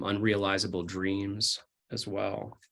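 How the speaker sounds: ordinary delivery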